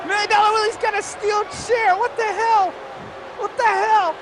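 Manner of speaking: high-pitched outburst of disbelief; alarmed commentary. Real, not acted. Commentator spots the steel chair in the match, alarmed. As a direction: call it high, in startled protest.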